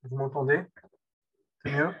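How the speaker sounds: projecting the voice; speaking up. Real, not acted. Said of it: "je peux parler plus fort".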